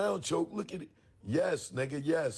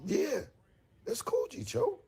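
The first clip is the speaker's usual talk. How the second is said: higher mock voice for the friend; Jay's reply; 12 semitones higher than usual. The friend confirming the sweater, in a higher mock voice. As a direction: lightly play his buddy agreeing. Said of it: "And he's like".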